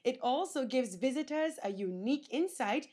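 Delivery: bright, energetic TV presenter read; news reporter. Acted. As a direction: deliver it like a travel-show host. Reporter's upbeat stand-up.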